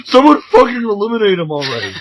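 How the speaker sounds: mock exasperated yell. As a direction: yell it out half laughing. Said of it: "the entire match is like".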